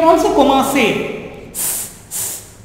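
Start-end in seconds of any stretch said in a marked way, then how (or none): 1.5-2.6 a long unvoiced s, very soft; just hiss the s; hissed s sound. Shown as a demonstration. Teacher showing the s sound alone. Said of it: "s sound".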